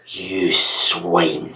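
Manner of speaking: hissed under the breath, soft and savage; quiet vehemence. A narrator's reading. Master whispering his contempt at the servant. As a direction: whisper the threat with venom. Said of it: "with quiet vehemence".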